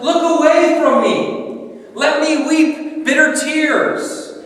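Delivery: lamenting God. Acted. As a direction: plead to be left to weep. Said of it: "In Isaiah twenty-two four, it says".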